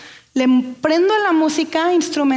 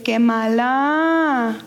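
put-on long drawn-out little-girl whine; little girl from TikTok; 2 semitones lower, much slower than usual. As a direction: imitate a girl, stretching the vowels slowly. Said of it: "una niña que dice".

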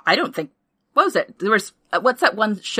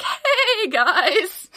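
much higher, slow, laughing plea; sheepish mock plea; 13 semitones higher, slower than usual. Crew begging the merchantman for help, high and laughing. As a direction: squeak it out like an embarrassed favor. Voicing the crew's awkward request.

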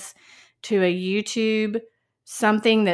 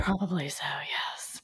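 whispered aside; 11 dB quieter than usual. Real, not acted. Quiet aside.

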